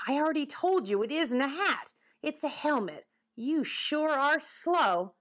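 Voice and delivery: high-pitched, impatient correction; exasperated. Acted. Woodchuck correcting the boy about his helmet, exasperated. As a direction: drag out the end in exasperation.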